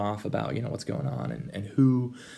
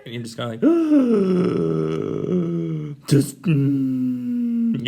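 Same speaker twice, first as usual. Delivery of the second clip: frustrated groan; 6 semitones higher, 6 dB louder, slower than usual. Retold or imitated. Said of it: "just frustrating".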